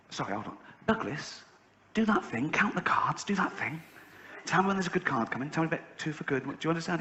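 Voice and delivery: half-whispered, keeping it secret from the table; hushed urging. Retold or imitated. Secretly urging boy at casino.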